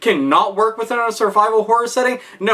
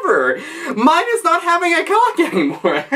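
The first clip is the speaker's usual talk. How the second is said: much higher, gleeful mock cheer; hammed-up excitement over a stupid plan; mock enthusiasm; 10 semitones higher than usual. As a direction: sell a terrible idea with fake glee. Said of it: "and saying".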